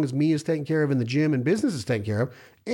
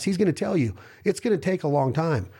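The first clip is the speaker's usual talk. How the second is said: usual speaking voice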